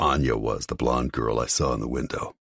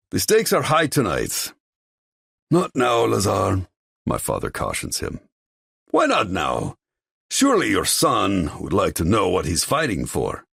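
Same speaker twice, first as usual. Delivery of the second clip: smug rival; 7 semitones higher than usual. Acted. Lazar provokes the father, smug. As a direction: tease out the stakes.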